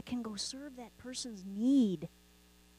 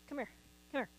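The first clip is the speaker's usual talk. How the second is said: ordinary talk, nothing marked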